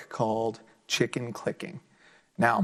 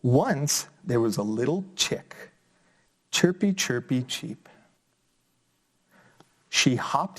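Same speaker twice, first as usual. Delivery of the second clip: put-on lively, sing-song story reading for kids; reading to children; slower than usual. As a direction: bring the picture book alive for little listeners. Reads picture book aloud.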